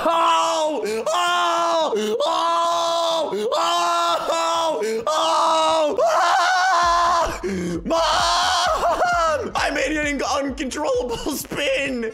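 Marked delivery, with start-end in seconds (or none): 0.0-6.0 high, loud shouted whoas and ohs; alarmed shouts. Acted. Baby pushed too high on the swing, loud alarmed shouts. 6.0-9.5 very high, loud scream for mom; panicked scream. Acted. Baby flung off the swing screaming for his mom, panicked scream.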